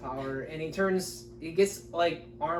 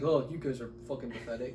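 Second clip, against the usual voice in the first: lightly voiced sneering god, lower and softer; dismissive Raiden; 5 semitones lower, 6 dB quieter than usual. Friend imitating the rude thunder god in the movie, lightly voiced sneer. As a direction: look down on them with lazy contempt. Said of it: "He's like".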